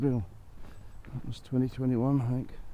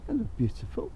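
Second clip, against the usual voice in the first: doting animal-lover coo; high cooing; 11 semitones higher than usual. Real, not acted.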